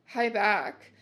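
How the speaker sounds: ordinary delivery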